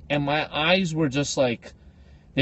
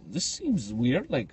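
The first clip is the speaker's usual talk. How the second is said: drowsy muttering to himself; dazed mumble; 4 semitones lower, 7 dB quieter than usual. Retold or imitated. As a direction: sound half asleep and puzzled. Confused self off plane.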